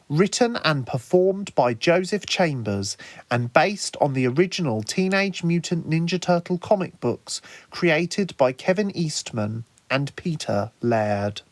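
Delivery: usual speaking voice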